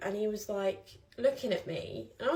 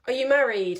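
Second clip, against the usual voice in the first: loud prying drunk, lightly voiced; louder nosy question; 4 semitones higher, 7 dB louder than usual. Retelling the drunk man prying on the tube, loud. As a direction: blurt it across the carriage.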